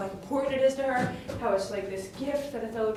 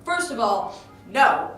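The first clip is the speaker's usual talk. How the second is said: loud, punchy objection; emphatic protest; 4 semitones higher, 5 dB louder than usual. Retold or imitated. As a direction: retell telling someone to chill, hitting each point hard. Retells protesting Othello's jealous suspicion.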